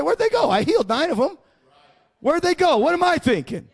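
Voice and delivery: lightly acted bewilderment; puzzled Jesus. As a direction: wonder aloud where they went, pitch jumping up. Voicing Jesus puzzled over missing healed.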